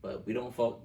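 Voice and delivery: usual speaking voice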